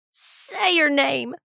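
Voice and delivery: high, drawn-out demanding cry; screamed. A narrator's reading. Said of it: "Rob screamed into the silence".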